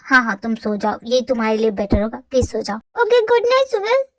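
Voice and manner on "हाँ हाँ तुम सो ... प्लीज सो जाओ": put-on boyfriend voice. Boyfriend telling girlfriend to just sleep, low teasing voice. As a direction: lower male voice, dismissive and teasing.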